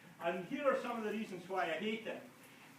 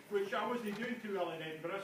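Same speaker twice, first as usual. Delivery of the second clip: ordinary delivery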